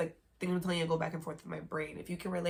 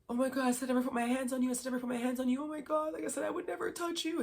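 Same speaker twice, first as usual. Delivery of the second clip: high, rapid mock panicked pleading; imitated crying breakdown; 8 semitones higher, much faster than usual. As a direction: imitate him losing it in frantic, rising apologies. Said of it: "breaking down crying".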